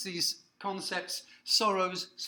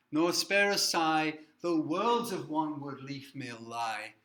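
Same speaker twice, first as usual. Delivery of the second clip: louder, slowed declaiming recital; slow, loud recital of a hard truth; dramatic poem reading; 6 dB louder, slower than usual. Acted. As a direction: proclaim the grim turn of the poem. Reads the colder turn loudly.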